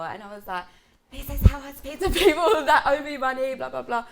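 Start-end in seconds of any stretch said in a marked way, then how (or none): 1.1-4.0 louder, higher comeback with a laugh in it; indignant replayed retort; retold heated retort. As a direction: throw the accusation back, loud and high.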